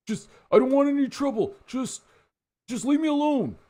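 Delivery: high, fast, frightened begging; scared pleading. Acted. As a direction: plead for your life, voice thin. Scared thug pleads at gunpoint.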